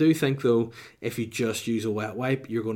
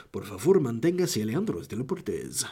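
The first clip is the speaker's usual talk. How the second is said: lilting put-on Spanish accent; Spanish monorail announcement. Monorail warning at Disney World, Spanish announcer accent. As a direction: imitate a Spanish announcer with swooping pitch. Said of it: "can really say in Spanish".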